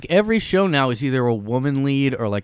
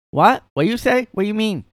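much higher, indignant mock Chinese accent; 12 semitones higher, 5 dB louder than usual. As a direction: squeak out the worker's offended reply in a put-on Chinese accent. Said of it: "they talk like that".

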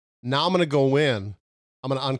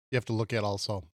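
ordinary delivery

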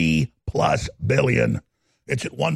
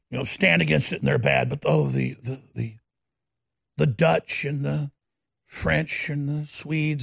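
normal talking voice

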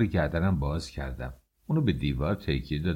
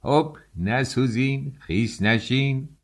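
slow, higher warning called through the crowd; calling out; 6 semitones higher, slower than usual. A narrator's reading. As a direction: warn the crowd as you push through with tea. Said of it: "مرتب داد میزد".

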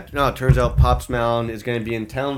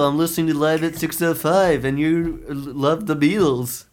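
hammed-up, higher Liverpool musician impression; 5 semitones higher than usual. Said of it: "my Beatles impression".

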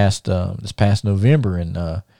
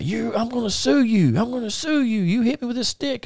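high-pitched mock whining threat; squeaky imitation of someone threatening to sue; complaining fan impression; 13 semitones higher, 3 dB quieter than usual. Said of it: "this guy starts talking about".

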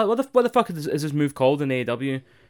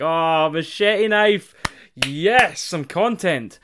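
higher, louder, slow overjoyed cheer for machete knife; excited pop; 5 semitones higher, 6 dB louder, slower than usual. Retold or imitated. Podcaster retelling his pop at an entrance, loud excited cheer. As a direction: cheer like a fan getting his favourite wrestler. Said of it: "I was like".

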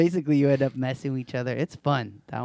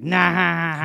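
smug refusal; 6 semitones higher, 7 dB louder than usual. Retold or imitated. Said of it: "be like".

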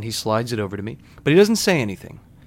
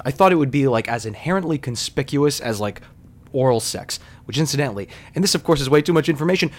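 normal talking voice

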